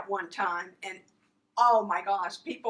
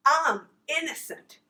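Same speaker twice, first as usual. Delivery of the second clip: louder, higher emphatic claim; firm declaration; 5 semitones higher, 6 dB louder than usual. Retold or imitated. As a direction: declare it firmly. Host re-voices the declaration.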